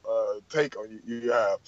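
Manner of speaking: ordinary talk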